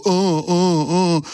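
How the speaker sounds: affected effeminate little uhs; mock effeminate. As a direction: simper the uhs in a put-on effeminate voice. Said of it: "just act real effeminate and go".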